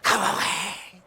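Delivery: usual speaking voice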